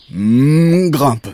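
moo, then give the order; vache de conte. Acted. La vache décide de grimper. (vache qui meugle avec entrain)

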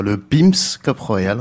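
"Pim's Cup Royal" said slowly in fluent English; slow, confident English accent on the name; proper English pronunciation. Shown as a demonstration. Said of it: "Prononcez-le bien, vous qui êtes super anglophone".

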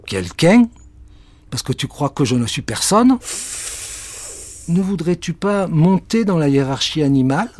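hammed-up suspicious cat; indignant cat. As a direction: take offence at the witch.

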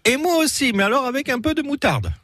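high-pitched comic character voice; Tournesol. Acted. Host quoting the album's last reply, high put-on voice. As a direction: pitch it way up for a dotty quoted reply.

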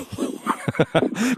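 breathy amused laugh; a quick airy chuckle; soft laugh. Real, not acted. Host amused by the Breton caller, laughing softly.

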